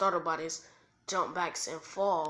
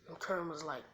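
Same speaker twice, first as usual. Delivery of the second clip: ordinary delivery, nothing marked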